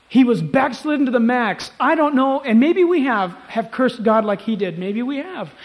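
loud, high preacher's cry; impassioned preaching. Acted. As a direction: preach it out at full force. Preaches at full force.

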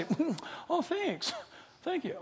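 small, high, soft voice; mock thankful recipient. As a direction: play an offhand thanks, lightly and high. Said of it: "somebody to sit there on the other end".

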